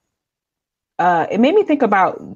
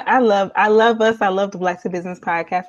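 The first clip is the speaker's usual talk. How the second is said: normal talking voice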